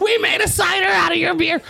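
high shout through laughter; laughing shout. Real, not acted. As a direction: yell it out while laughing. Shouting the prank into the call.

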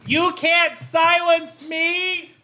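slow, high defiant protest. Pretend. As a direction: refuse to be silenced, dragging it out. Defiant after mics cut.

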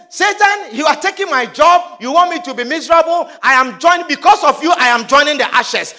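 loud, high declaration to Satan; acted full-force defiance; angry defiance. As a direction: shout down the devil with new resolve. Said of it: "Be angry".

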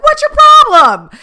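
high, loud mock-angry yell; mock snap. Worn-out driver to a stranger: loud high mock snap. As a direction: blow up at a stranger, hammed up.

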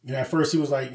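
ordinary talk, nothing marked

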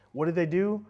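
ordinary talk

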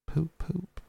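tiny high-pitched horn imitation; entranced little high hoot; faint murmur. A narrator's reading. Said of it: "at intervals he faintly murmured".

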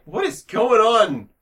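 slow, high, baffled; mock disbelief, drawn out; bewildered. Watching the dad's cab scene, slow baffled disbelief. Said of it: "And I'm like".

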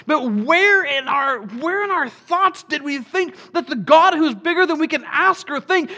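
a preacher driving the point home to the church; preaching at full force. Real, not acted. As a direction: build it into a passionate climax.

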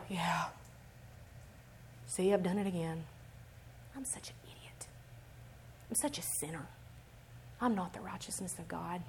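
low, very soft, defeated murmur; dejected self-condemnation. Acted. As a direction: sink into hushed self-blame, pausing between thoughts. Acting out self-condemnation after sinning.

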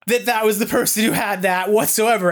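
stunned and amused, laughing it out; laughing disbelief. Retold or imitated. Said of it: "And I went".

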